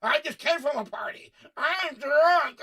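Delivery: mock party talk building to a loud shout. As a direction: start chatty, end yelling drunk. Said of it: "Have you been going to the bar?".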